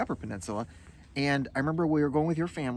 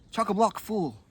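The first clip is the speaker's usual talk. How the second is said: higher mock Australian; playful Australian slang delivery; Australian accent; 8 semitones higher, 4 dB louder than usual. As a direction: show off an Australian twang. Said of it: "Australian's more like".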